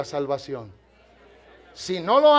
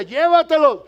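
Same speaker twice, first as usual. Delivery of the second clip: loud order; 8 semitones higher, 9 dB louder than usual. Retold or imitated. Guards ordered to take prisoners away.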